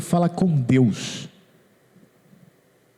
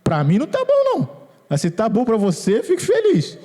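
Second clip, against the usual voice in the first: high-pitched, jumping exclamations; playful retort; 7 semitones higher than usual. Real, not acted. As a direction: toss back a high, teasing objection. Playful retort to imagined objector. (réplica brincalhona, em voz aguda)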